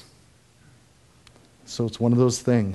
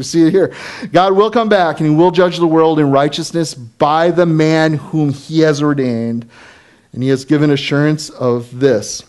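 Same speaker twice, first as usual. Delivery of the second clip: preaching; 5 semitones higher, 7 dB louder than usual. Acted. Preaching at full force.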